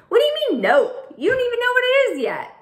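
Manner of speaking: high, fast incredulous protest; can't believe she said no; high-pitched outraged disbelief; indignant disbelief. Acted. Coralie protests the refusal.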